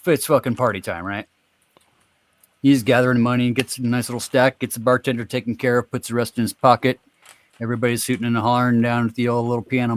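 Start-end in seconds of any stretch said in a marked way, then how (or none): none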